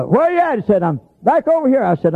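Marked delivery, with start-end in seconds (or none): 0.0-0.6 high-pitched retold call; hollering back. Hunter answering a lost man, imitated high holler. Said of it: "I got hollering back at him". 0.8-1.8 high retold answering call; calling back. Lost man answering the hunter, imitated high call. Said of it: "I got hollering back at him".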